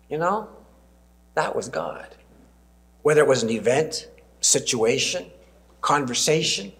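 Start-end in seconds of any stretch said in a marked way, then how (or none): 0.1-2.1 soft, reflective aside; softly musing to oneself; hushed realization. Acted. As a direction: say it quietly, as a thought dawning. Acting a moment of realization.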